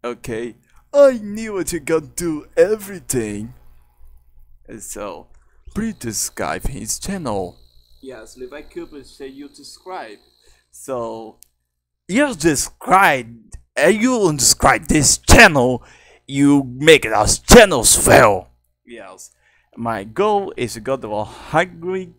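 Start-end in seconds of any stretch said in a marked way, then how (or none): 0.9-3.5 high, loud cartoon voice; character voice. Performing a character's line. 12.1-18.5 shouting character. Acted. Character ordering viewers to subscribe.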